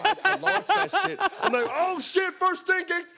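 high-pitched retold panic; acted-out panic at a crash; panicked outburst. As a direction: blurt it out in a high, frantic voice. Acting out panicked beer toss.

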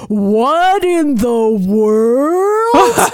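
very slow, dragged-out slow-motion voice; slowed down deep. Retold or imitated. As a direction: drag it like footage played at half speed. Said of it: "in a slowed down voice goes".

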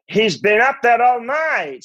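high, drawn-out cod Scandinavian cry. Acted. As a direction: wail it slowly in a mock Scandinavian accent. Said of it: "let me do it on another line".

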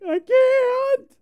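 very high, loud comic sob-wail; mock wail. Crier told by friends to stop, mock wailing. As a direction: squeal it out in hammed-up helpless tears. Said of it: "you know I'm a crier".

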